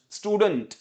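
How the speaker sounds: स्टूडेंट as STOO-dunt, falling; first correct version. Shown as a demonstration. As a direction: repeat स्टूडेंट with reduced 'dunt'. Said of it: "either बोलिए स्टूडेंट". (स्टूडेंट में हल्का 'डंट')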